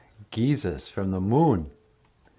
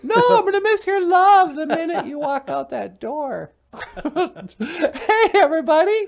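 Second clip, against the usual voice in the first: high alien voice. Acted. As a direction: greet him like a tiny cartoon alien.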